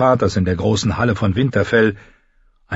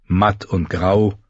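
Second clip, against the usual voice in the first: usual speaking voice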